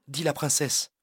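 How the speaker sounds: plain reading voice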